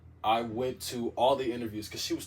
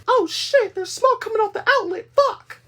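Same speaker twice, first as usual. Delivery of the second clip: high, loud mock scream from far off; panicked yell; 15 dB louder than usual. Roommate discovering smoke, high frantic scream. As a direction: shriek it in panic. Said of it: "I hear".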